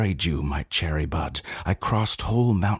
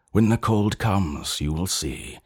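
ordinary delivery

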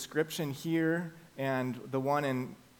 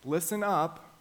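calling out; 3 semitones higher, 3 dB louder, slower than usual. Shown as a demonstration. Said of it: "And Jesus says".

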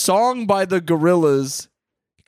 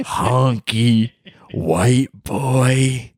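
slow, low drawn-out sneer; cranky old man; 3 semitones lower, much slower than usual. Acted. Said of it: "you cracker".

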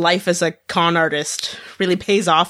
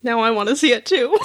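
laughing; 4 semitones higher, faster than usual. Real, not acted. Laughing excitedly.

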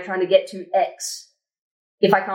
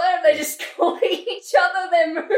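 laughing; 8 semitones higher than usual. Real, not acted. Joking about tiny planets.

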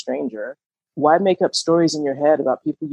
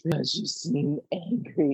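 soft echo; 9 dB quieter, slower than usual. Real, not acted. Echoing the card softly.

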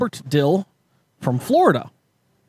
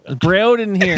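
high, loud pretend police shout; mock cop calling; 5 semitones higher, 4 dB louder than usual. Pretend police calling for Breod, loud and high. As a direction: call through the door as a cop.

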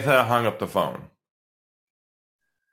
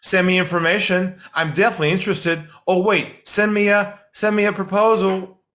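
higher, louder breezy fake-keen buyer; mock brush-off prospect; 6 semitones higher, 3 dB louder than usual. Prospect stringing a salesman along, mock chirpy. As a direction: brush him off, chirpy and noncommittal. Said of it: "It's okay to say things like".

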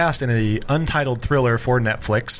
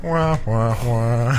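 mock sad trombone; much slower than usual.

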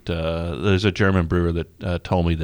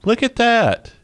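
disgusted surprise; 4 dB louder, slower than usual. Real, not acted. Brewer sees cloudy lazy beer.

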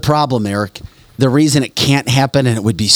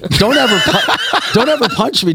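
laughing; 10 semitones higher than usual. Real, not acted. Laughing after being punched.

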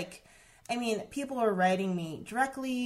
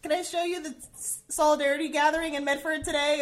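higher and louder, eager invitation; eager invite; 8 semitones higher, 6 dB louder, faster than usual. Retold or imitated. Inviting the daycare mom, eager and higher. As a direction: pitch it up and pitch the offer brightly. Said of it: "I'm like".

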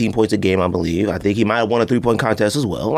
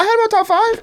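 sudden high squeak of realization; squeaky surprise. Real, not acted. Caught mid-argument, a squeaky surprised yelp.

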